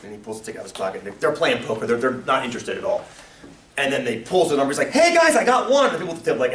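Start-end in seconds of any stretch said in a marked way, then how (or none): 4.9-5.8 higher, louder eager call; mock excited player. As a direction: tell your buddies you won something. Ad character voiced in story.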